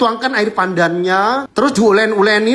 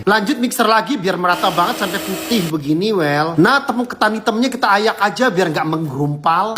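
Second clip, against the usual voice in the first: ordinary talk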